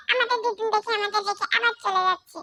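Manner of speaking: ordinary talk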